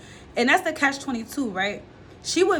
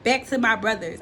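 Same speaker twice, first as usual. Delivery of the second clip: ordinary delivery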